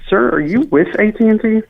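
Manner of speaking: high-pitched, exasperated demand; irritated challenge. Real, not acted. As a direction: challenge a suspicious caller, pitch jumping up. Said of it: "yell at me like that".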